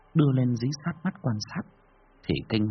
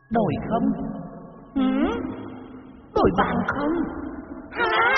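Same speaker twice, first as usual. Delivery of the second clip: ghost hissing. Acted. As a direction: screech the demand like a ghoul. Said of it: "mà rít lên".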